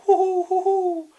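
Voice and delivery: high hooting owl call; high, loud owl hooting; owl hoots. Acted. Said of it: "the owl was saying".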